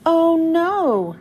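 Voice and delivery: plain reading voice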